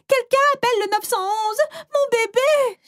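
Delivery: high, frantic mother crying for help; panicked shout. Acted. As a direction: scream for an ambulance. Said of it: "s'est mise à crier pour une ambulance".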